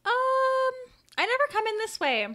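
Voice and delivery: put-on high, loud kid voice; high-pitched child; little boy answering. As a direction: pipe up like an unsure boy.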